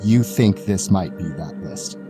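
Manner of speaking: usual speaking voice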